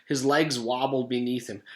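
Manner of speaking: usual speaking voice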